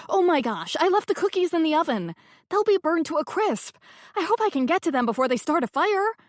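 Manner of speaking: hammed-up panic about burning cookies; faked panic. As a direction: pretend to rush off in a fluster. Narrator fakes alarm about cookies.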